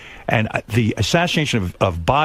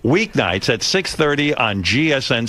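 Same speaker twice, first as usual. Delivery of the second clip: brisk radio plug read; host slips a show plug into the chat, promo read; quick promo read; 4 semitones higher than usual. Acted.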